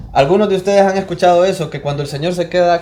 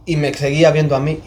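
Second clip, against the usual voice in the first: usual speaking voice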